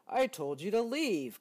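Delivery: loud exasperated bear; cried out. Acted. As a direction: cry it out in frustration. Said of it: "cried Bear".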